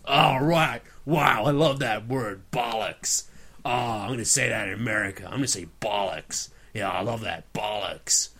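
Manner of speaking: a hint of an American savouring a new word; American accent. An American friend learns the word bollocks, lightly voiced American accent. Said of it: "I taught an American the word bollocks".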